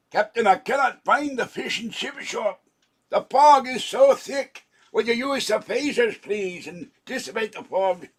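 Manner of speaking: a hammed-up Scotty begging for the phasers; Scotty impression. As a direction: call up to the captain like a worried Scotty. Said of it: "I'm not doing a very good Scotty".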